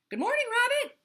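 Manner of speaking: loud high cheerful greeting; shout. Acted. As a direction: call out across the yard. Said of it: "shouted Hippo".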